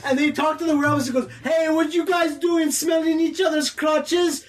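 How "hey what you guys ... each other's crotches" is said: higher, laughing put-on Kiwi accent; over-the-top New Zealand accent. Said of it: "stop with the accent".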